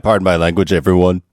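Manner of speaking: usual speaking voice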